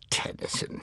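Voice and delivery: a pained, breathy near-whisper of the name; pained exclamation. A narrator's reading. Wife dismayed at husband's choice of poet, pained exclamation. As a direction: let the name escape you as if it hurts. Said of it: "she exclaimed painedly".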